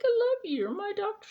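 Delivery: high, whiny and doting; mock pleading. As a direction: plead sweetly in a high squeak. Retelling pleading with her doctor.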